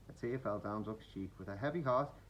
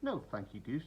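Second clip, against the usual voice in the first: usual speaking voice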